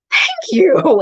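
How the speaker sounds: a flattered stranger beaming; delighted thanks. Retold or imitated. Birthday woman thanks her again.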